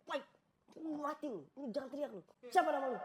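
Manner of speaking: high, agitated acted threat; threatening robber. Maling mengancam suami. (membentak mengancam)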